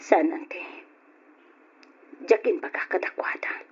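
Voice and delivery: low, soft whisper; hushed. Acted. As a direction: murmur the hurt. Hushed resentful reply.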